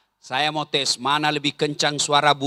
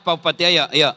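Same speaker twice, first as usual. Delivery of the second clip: ordinary delivery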